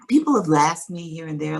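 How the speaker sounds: normal talking voice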